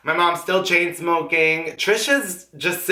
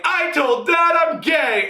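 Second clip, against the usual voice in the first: higher, slow, half-shouted confession; blurted out; 12 semitones higher, slower than usual. Retold or imitated. Blurts news to mom.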